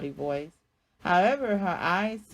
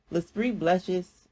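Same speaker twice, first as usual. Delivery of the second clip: ordinary delivery, nothing marked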